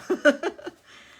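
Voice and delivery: high, loud laughing; amused laugh. Real, not acted. Streamer amused by her own reaction, loud high laugh. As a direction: laugh heartily at yourself.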